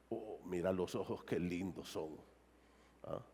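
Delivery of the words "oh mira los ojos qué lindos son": hushed, dreamy admiration, pitched low; softly gushing over lovely eyes; admiring murmur. Acted. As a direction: lower and much softer, admiring the eyes. Admiring someone's eyes, acted quote.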